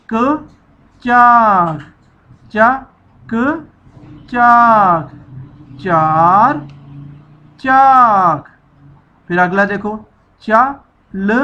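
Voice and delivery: ordinary delivery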